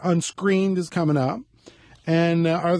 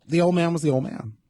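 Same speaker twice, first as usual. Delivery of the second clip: ordinary delivery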